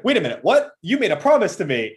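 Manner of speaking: high loud mock outrage; mock indignant fiancee. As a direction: play her stunned protest.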